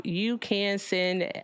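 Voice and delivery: ordinary talk, nothing marked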